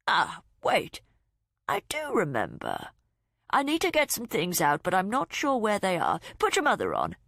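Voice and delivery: lightly voiced muddled father, rising; an old man groping for memory; a hint of a frail dad; confused old father. A narrator's reading.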